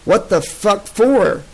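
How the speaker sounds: slow furious question; bristling outrage. A narrator's reading. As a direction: spit out the outrage slowly. Soldier bristling at captors.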